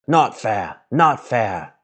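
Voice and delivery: a lightly voiced Gollum hiss. Gollum complaining to Bilbo, mild hiss. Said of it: "he hissed".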